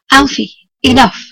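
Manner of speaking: loud firm command to the dog; firm scolding. Real, not acted. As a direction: tell the dog off sharply.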